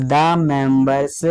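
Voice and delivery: drawn-out words for note-takers; slow dictation. Real, not acted. Teacher dictates an exam line to students, slow dictation. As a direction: say it slowly, word by word.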